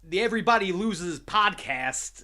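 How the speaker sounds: loud, slow mock Walken drawl; hammed-up Walken announcing the podcast; Christopher Walken impression. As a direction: intone it like a bad Walken. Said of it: "That was my Christopher Walken impression".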